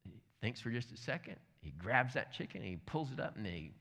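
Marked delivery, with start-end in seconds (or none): none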